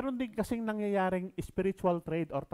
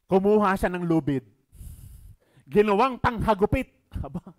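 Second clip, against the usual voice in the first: loud, raised preaching voice; preaching at full force; 7 semitones higher, 12 dB louder than usual. Acted. Pastor retelling temple scene, loud and forceful.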